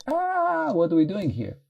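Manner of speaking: high alarmed yelp of the mind; panicked nervous system. Retold or imitated. Teacher voicing her panicking nervous system, high alarmed. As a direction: squeal it like a startled nervous system. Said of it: "nervous system get".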